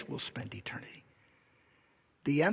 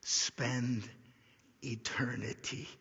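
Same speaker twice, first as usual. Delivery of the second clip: word by word, long pauses, sinking low; solemn conclusion; 3 semitones lower, 3 dB quieter, much slower than usual. Real, not acted. Preacher telling believers what makes heaven heaven, slow and hushed. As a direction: space out each phrase, softening to the end. Final point of the sermon.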